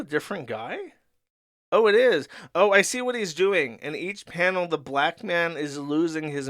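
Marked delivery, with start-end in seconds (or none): 1.7-3.8 higher and louder, a real oh of discovery; sudden realisation. Real, not acted. Streamer realising the comic's joke, surprised oh. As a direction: catch the joke as it dawns on you.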